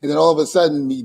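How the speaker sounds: ordinary talk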